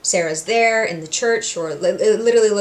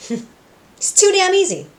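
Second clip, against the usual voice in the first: high, slow, smug villain gloat; a high drawn-out gloat of satisfaction; 10 semitones higher, slower than usual. Retold or imitated. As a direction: savour it like a scheming villain. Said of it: "Alistair's just like".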